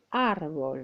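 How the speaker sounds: first A of árbol held long; árbol said as "aaar-bol", opening syllable prominent; stress on first syllable. Shown as a demonstration. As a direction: lean on the "ár" of árbol and stretch it. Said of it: "On insiste sur cette syllabe".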